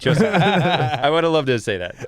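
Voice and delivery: laughing through the words; chuckling it out slowly. Real, not acted. To his friends about the rude tourist, laughing.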